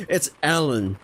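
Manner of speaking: Alan with open vowels, a-LAN; corrected pronunciation. Shown as a demonstration. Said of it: "No, that's a bit too English".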